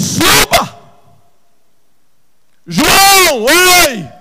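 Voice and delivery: loud, high shouted sermon; impassioned cry of God's voice; full-force preaching. Acted. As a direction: thunder it out, stretching the big words.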